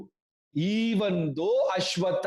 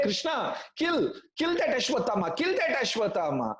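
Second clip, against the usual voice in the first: higher, fast insistent urging; eager demand; 5 semitones higher, faster than usual. Acted. Bhima urges Krishna to kill Ashwatthama, fast and insistent. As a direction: push hard for the kill, impatient.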